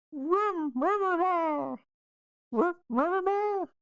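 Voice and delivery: very high mimicked squeak at a door; mouse squeaking. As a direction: squeak nonsense syllables like a mouse. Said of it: "they squeak at the door".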